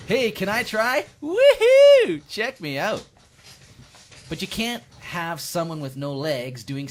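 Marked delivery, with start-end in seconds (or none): 0.1-3.1 high, loud whooping excitement; gleeful showing off. Acted. Wheelchair user trying a stunt, loud gleeful whooping. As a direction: shout it out like a thrill ride.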